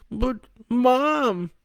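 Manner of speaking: put-on very high boy's cry; screaming back. A narrator's reading. Boy under the covers protests about Mom, screaming back. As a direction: protest in a high-pitched wail. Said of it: "Brandon screamed back, muffled by the covers".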